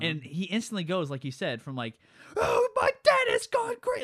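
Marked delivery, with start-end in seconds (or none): 2.4-4.0 very high, loud, slow mock wail; hammed-up frantic yell; at a ten. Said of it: "like he's at a ten".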